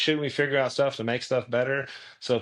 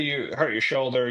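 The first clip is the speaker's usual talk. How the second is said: ordinary talk, nothing marked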